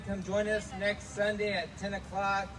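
ordinary delivery

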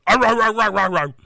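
loud high growling yells; overreacting growls. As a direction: growl it in a rage. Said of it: "somebody overreacting".

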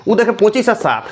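ordinary talk

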